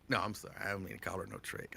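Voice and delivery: ordinary talk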